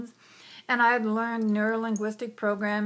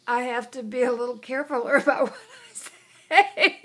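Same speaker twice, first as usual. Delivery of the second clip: laughing through the words, louder; 2 semitones higher, 4 dB louder than usual. Real, not acted. Speaker laughs at her childhood self, laughing. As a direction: laugh at yourself as you say it.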